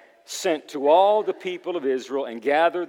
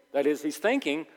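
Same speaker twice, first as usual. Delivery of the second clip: ordinary delivery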